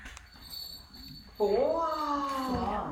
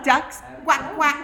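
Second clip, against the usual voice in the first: duck quacking; 4 semitones higher, 10 dB louder than usual. Pretend. Duck sound for toddler.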